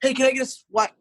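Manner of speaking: high-pitched mock staffer; pestering staffer. Staff stop a manager who is leaving, pestering. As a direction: imitate a coworker casually angling for a favor. Mimics staff asking for a swipe.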